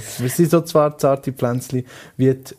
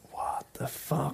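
whispered, slow what the fuck; a stunned inner whisper; hushed incredulous aside; whispered thought; 3 semitones higher, 15 dB quieter, slower than usual. Retold or imitated. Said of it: "Und sie denkt sich so".

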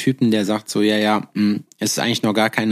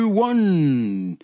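lucha libre announcer; 5 semitones higher than usual. Acted. Podcast intro, fight announcer style.

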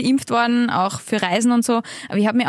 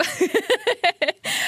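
high burst of laughter; self-amused laugh; 9 semitones higher, 3 dB quieter than usual. Real, not acted. Guest laughing at her own new fear of the result, high amused laugh.

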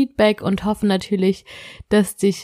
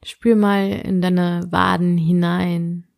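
sleep meditation; slower than usual. Acted. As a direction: guide the listener gently into sleep.

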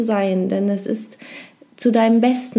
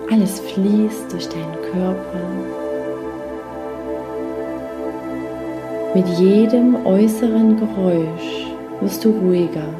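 guided meditation; 3 dB quieter than usual. Acted. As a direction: lull them gently into rest.

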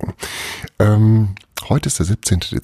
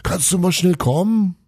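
high calling across a hall; shouting; 14 semitones higher than usual. A narrator's reading. Elf calling Santa across the hall, urgent shout. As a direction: call out urgently to the boss. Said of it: "schrie einmal quer".

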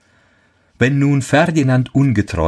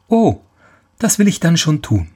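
put-on girl's voice; 9 semitones higher than usual. Girl offers help.

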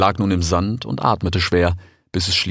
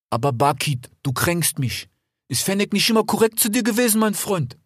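put-on a slightly higher, friendly young fox; lightly voiced fox; 12 semitones higher than usual. A narrator's reading. Desert fox talking to his brother Rix, lightly voiced. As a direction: play the eager desert fox. Fennec voice.

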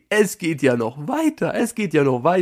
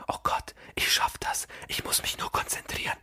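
hushed self pep talk, voiceless; imitated whisper; 12 dB quieter than usual. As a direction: whisper courage to yourself. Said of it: "Genauso hat er geflüstert".

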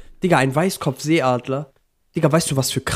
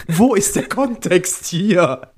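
keuchendes Lachen; 7 semitones higher, much slower than usual. Real, not acted. Laughing, slowed, breathless.

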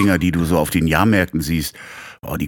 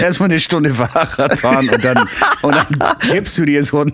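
laughing; 6 semitones higher than usual. Real, not acted. Malt absurde Döner-Szene lachend aus.

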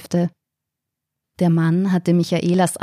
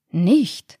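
mildly astonished. A narrator's reading. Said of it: "fragte der Mann erstaunt".

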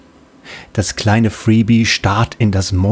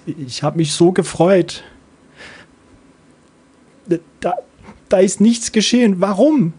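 high, bewildered little voice; a disappointed kid asking why; sad puzzled child; 9 semitones higher than usual. Acted. Sad little Freebie asking the bot why, high puzzled child voice. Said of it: "Das traurige Freebie fragt verdutzt".

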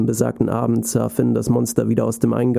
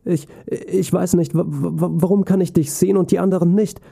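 put-on higher, stammering child voice; stuttering; 6 semitones higher than usual. A narrator's reading. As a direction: stumble over the first words, nervous. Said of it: "Finn begann zu stottern".

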